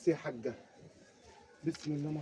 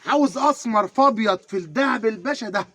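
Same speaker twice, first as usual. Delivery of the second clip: ordinary talk, nothing marked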